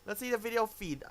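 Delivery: ordinary talk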